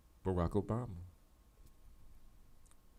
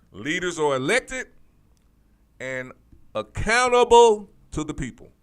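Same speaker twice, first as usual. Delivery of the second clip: emphatic louder proclaiming; 7 semitones higher, 14 dB louder than usual. Real, not acted. Telling viewers what democracy means, preached louder. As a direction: preach it louder, stretching accountable.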